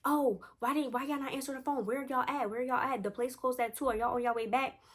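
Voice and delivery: a hint of a fretting friend asking where you are; worried friend on phone. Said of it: "She's like".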